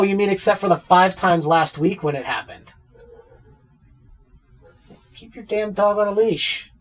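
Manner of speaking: usual speaking voice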